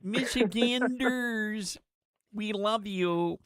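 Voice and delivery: high, slow, drawn-out put-on accent; mock Haley Stevens voice. Host mocking a candidate's accent on air, high and slow. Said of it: "I love her accent".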